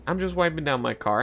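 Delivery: ordinary talk, nothing marked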